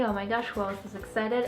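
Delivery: normal talking voice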